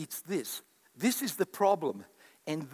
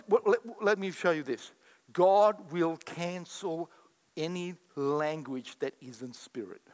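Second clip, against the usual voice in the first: normal talking voice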